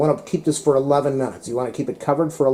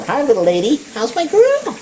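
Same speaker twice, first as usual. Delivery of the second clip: high sing-song pet voice; 12 semitones higher, 3 dB louder than usual. Real, not acted. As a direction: coo at a beloved dog, much higher. Greeting her dog sweetly.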